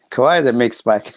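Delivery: laughing. Real, not acted. Amused repeat of speaker's phrase.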